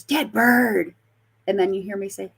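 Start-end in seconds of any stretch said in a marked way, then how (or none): 0.0-0.9 retold ghost voice. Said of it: "this voice goes".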